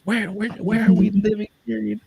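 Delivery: high, panicky city-girl squeal, imitated; mock freaked-out wife. Husband mimics his wife panicking about where they live, mock shrill. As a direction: play the alarmed wife, voice jumping high. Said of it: "she flipped and goes".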